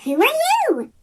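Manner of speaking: high, small curious peep. A narrator's reading. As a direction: pipe the question up high. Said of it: "peeped Thomas".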